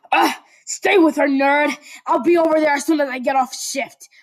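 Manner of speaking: loud, gruff command; frustrated order. Acted. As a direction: bark the order. Katsuki commanding.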